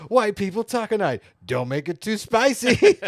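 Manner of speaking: high, loud exaggerated announcing; hammed-up, high and loud; mock meme caption voice. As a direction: belt out the meme line in mock alarm.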